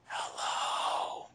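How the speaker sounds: drawn-out whispered hello; imitated ghostly whisper. Host retells the voice on his tape, ghostly whisper.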